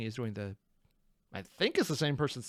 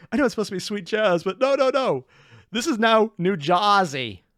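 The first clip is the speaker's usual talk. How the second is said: excited; 5 semitones higher, 8 dB louder than usual. Real, not acted. Mock protest over the accent.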